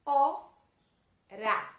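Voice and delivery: "Ora" split loudly into o... ra; two strong separate beats in "Ora": o, ra; syllable by syllable. Shown as a demonstration. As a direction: sound out "Ora" with a long pause after the o. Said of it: "também tem duas sílabas".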